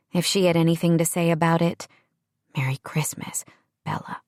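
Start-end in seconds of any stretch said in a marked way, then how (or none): 2.6-4.2 soft tender whisper. A narrator's reading. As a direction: breathe it close to her ear. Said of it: "Sam whispered".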